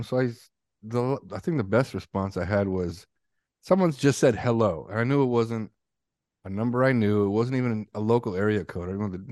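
normal talking voice

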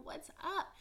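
What's up? sung out high with up swooping upward; high rising greeting. Shown as a demonstration. Greeting her podcast listeners with a high rising What's up. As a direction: lift What's up? into a bright high rise. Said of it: "Hey guys".

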